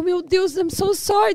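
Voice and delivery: replaying her own frantic apology; panicked apology. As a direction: gasp it out in a hurry of alarm. Panicked apology to fallen patient.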